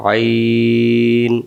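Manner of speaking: আইন drawn out slowly, pressed from mid-throat; a long, strained আইন; throat-pressed ain. Shown as a demonstration. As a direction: squeeze আইন from the middle of the throat, long and slow. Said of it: "হলকের মধ্য থেকে একটু চেপে পড়তে হয়".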